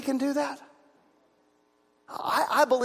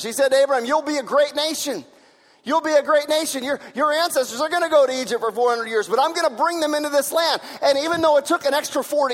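higher, louder preaching voice; preaching at full force; 5 semitones higher, 4 dB louder than usual. Acted. Pastor voices God's promise to Abraham for the congregation, fervent preaching. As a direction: declare the promise with fervor.

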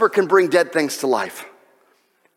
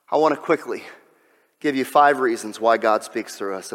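ordinary delivery

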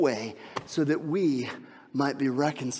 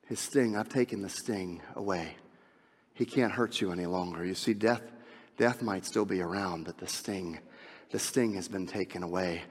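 hushed preaching; 7 semitones lower, 6 dB quieter than usual. Acted. Quiet intimate preaching passage.

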